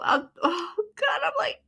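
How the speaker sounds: high, teary laughing break; crying with laughter. Real, not acted. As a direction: voice cracking from laughter. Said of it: "I got tears rolling down my face".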